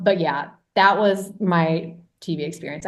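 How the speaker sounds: usual speaking voice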